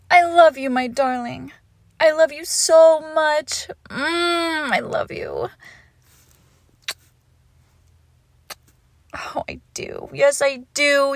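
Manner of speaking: loud, effusive declarations of love; gushing adoration. Acted. Lover smothering their pet with love, gushing adoration. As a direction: pour out love, drawing out the key words.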